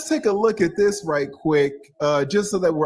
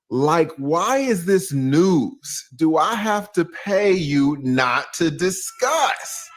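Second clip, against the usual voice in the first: slow quoted reply; slower than usual. Retold or imitated. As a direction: read it slowly, mildly indignant. Reading the quoted reply again.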